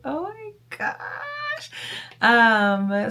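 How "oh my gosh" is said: high, slow and soft swooning "Oh my gosh"; high, soft, slow awed mom voice; gushing oh my gosh. Retold or imitated. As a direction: melt over your kid. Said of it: "so I'm like".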